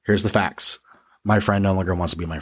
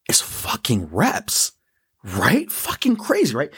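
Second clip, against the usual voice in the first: emphatic whisper; 4 semitones higher, slower than usual. Real, not acted. Stressing a key point.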